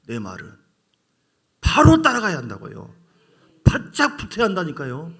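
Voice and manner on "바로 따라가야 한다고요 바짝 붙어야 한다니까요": louder, insistent call to follow closely; loud exhortation. Real, not acted. Preacher urging the congregation to follow closely, loud and insistent. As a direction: push the crowd to stick close.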